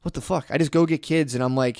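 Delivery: ordinary talk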